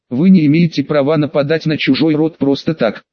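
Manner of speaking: ordinary delivery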